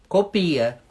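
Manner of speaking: ordinary talk, nothing marked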